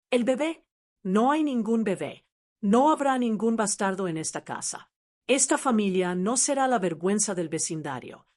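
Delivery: usual speaking voice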